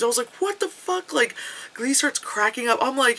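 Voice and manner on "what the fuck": high-pitched retold shock; mock disbelief. Viewer reacting to the pixel death, comic shock.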